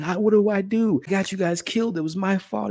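a hint of a broken raccoon blaming himself; distraught Rocket. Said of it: "And he's like".

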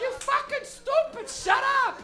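high, loud, slow mother voice; angry mom. Retold or imitated. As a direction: imitate an exasperated mom yelling insults. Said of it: "And she goes".

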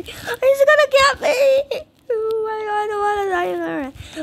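mock sobbing as the monster closes in; mock crying. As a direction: wail it like a scared kid, half joking. Monster chasing, she fake cries.

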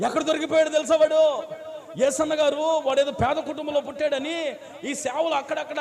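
high, loud preaching of the tale; a preacher's story at full force; loud sermon storytelling. Acted. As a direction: drive the story home from the pulpit.

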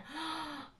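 sharp, soft gasp of delight. Real, not acted. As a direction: gasp as if seeing a dream chair. Delight at the lounge chair.

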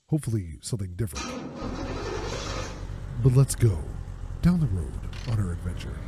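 low voice intro. Real, not acted. Gamer doing the intro in his deep voice. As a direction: keep it low and quiet. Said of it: "I've been talking like this".

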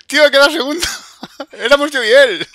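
buzzing after the match; excited gamer. Real, not acted. Match finished second.

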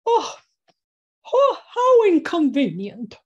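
high, loud giraffe voice with a swooping fall; giraffe character voice. Shown as a demonstration. Storyteller demonstrates her tall giraffe delivering a line, giraffe character voice. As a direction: play a haughtily put-out giraffe, high and loud. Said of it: "I deliver the line".

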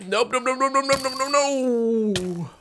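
high rapid panicked nopes; backing away in panic. Real, not acted. Mob about to hit.

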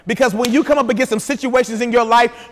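ordinary talk

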